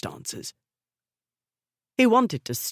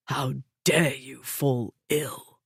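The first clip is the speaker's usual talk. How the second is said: low and rough; 2 semitones lower, slower than usual. A narrator's reading. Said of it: "His voice was low and rough".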